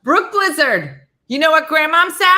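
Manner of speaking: loud scolding call to daughter; scolding shout. Real, not acted. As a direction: raise your voice at her. Scolding daughter about parking.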